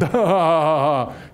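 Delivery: a short scornful laugh; mocking laugh. Joker laughs at Jesus on the cross, scornful laugh. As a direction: laugh at him like the jeering bystander.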